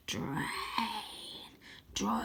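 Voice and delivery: Croaky casting his spell, hoarse and hushed; hoarse whisper. A narrator's reading. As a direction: barely breathe the word. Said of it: "a hoarse whisper".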